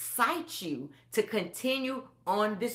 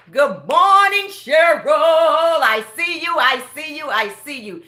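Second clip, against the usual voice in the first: loud, sung-out shout-out; loud greeting; 5 semitones higher, 11 dB louder than usual. Real, not acted. Greeting viewer Cheryl in the live chat, sung-out and loud. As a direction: call her name out with joy.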